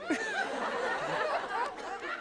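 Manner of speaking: high, soft giggle. Real, not acted. Laughing during story.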